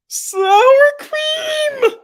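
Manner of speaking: very high, loud cartoon squeal; Goo impression. Streamer quoting Goo for chat, high loud squeal. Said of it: "says, says Goo".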